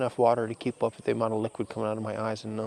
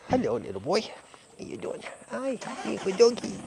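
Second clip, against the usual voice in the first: talking to a dog; 8 semitones higher than usual. Real, not acted. Greeting a dog in the sun.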